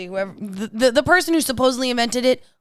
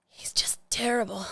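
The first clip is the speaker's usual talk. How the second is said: whispered; 7 dB quieter than usual. Real, not acted. Fond complaint about the cat.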